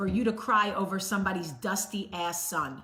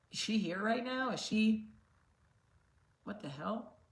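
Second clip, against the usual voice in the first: quiet, puzzled voice of a stranger watching; hushed, wondering aloud if she means you; imagined viewer; 8 dB quieter than usual. Retold or imitated. A viewer asking herself if the video is about her, puzzled and quiet. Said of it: "someone ... going".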